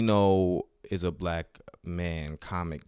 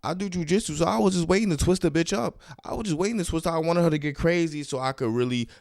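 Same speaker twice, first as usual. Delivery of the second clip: excited; 9 semitones higher than usual. Real, not acted. Boasting about twisting her up.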